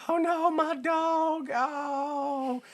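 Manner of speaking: high, drawn-out mock lament; mock wail. Imitating Ernest crying out for his dog, mock wail. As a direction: wail it slowly, hamming up the heartbreak.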